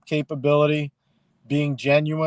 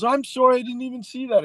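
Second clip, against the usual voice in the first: apologetic mother; 10 semitones higher than usual. Retold or imitated. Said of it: "And she goes".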